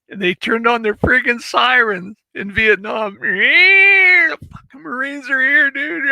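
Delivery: loud, high, slow hollering; whooping battle cry; excited shout. Retold or imitated. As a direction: yell it like the Marines just landed. Sirens, Marines arrive shouting.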